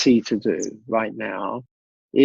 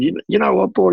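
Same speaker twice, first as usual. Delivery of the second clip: lightly imitated Aussie builder, pitched up; a touch of friendly Aussie bloke; Aussie accent; 7 semitones higher, faster than usual. Builder telling his mentor about his business, light Aussie accent. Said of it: "in this lovely Aussie accent".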